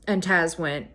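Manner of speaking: ordinary talk, nothing marked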